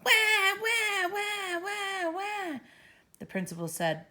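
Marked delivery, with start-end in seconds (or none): 0.1-2.6 put-on very high screaming baby wail. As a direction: scream like a furious infant. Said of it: "It screamed really loud".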